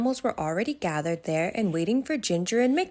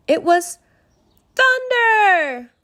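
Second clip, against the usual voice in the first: much higher, louder dramatic cry; thunder shouted; 14 semitones higher, 9 dB louder, much slower than usual. A narrator's reading. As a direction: shout the scary word thunder. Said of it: "They all shouted".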